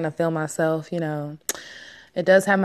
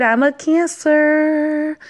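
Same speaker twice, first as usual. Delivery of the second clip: playful; 9 semitones higher, much slower than usual. Pretend. Playful aside.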